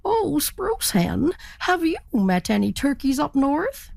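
calling up to a bird overhead; turkey voice. Acted. Turkey asks spruce hen.

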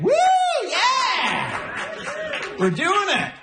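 high, whooping cheer; mock celebration. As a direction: whoop it up like a big win.

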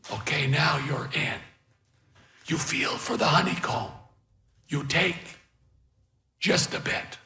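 put-on soft, hushed, halting old man's voice; patient old beekeeper. A narrator's reading. Old beekeeper guiding helpers into the hive, hushed and patient.

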